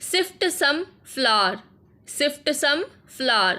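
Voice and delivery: very slow, each word spaced for learners; slow teaching repeat. Shown as a demonstration. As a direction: spell it out slowly so students can copy. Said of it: "repeats phrase for learners". (धीमे-धीमे, सीखने वालों के लिए)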